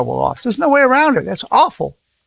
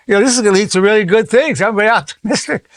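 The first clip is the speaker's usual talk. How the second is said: raised, quick, gushing fake cheer; mock optimism; 6 semitones higher than usual. Telling a bereaved man it's great, mock high cheer. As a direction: pretend to be thrilled by tragedy. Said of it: "if I just would've said".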